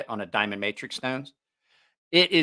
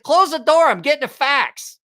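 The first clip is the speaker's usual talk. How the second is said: higher, louder urgent command; punchline shout; 13 semitones higher, 9 dB louder than usual. Retold or imitated. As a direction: snap the punchline like a busy businessman. Punchline, businessman in bathroom.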